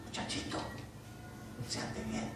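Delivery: unvoiced teacher's whisper. Preacher imitating his teacher correcting him, whispered. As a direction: hush the boy gently. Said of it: "Así me decía un maestro".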